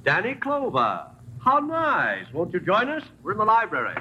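smooth host. Acted. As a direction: purr it like a smug host. Suave host greets visitor.

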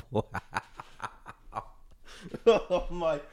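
ordinary delivery

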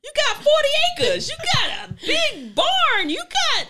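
high, loud, incredulous grandmother yelling; mock the girl's dream of love at the top of your voice; scoffing grandmother. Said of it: "And the grandmother was like".

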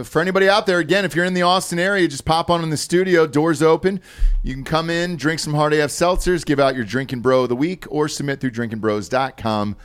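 announcer read. Acted. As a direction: pitch it to the listeners like an ad. On-air promo to listeners.

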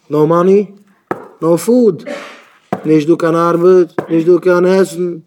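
loud, emphatic short phrases; loud insistence. Acted. Worker explaining why he must work, loud and insistent. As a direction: drive each phrase home loudly. Acted answer in the work argument.

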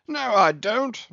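high, loud captain's retort; captain's denial. A narrator's reading. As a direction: jump in with a hearty denial. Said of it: "said the captain".

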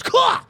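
high, loud sudden kah; sharp attack shout. Retold or imitated. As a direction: bark it like a lunging killer. Imitates game killer's attack.

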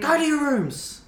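high, loud, slow stern parent shout; hammed-up parent yelling at kids; mock parent yell. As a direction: bellow it like an angry parent.

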